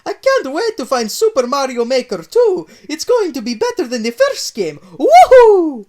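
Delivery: high, loud Italian plumber glee; excited Mario. Acted. Mario hunting his game, high excited Italian voice. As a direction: bounce it like Mario overjoyed. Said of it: "with the uttermost joy".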